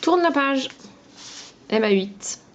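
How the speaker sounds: ordinary talk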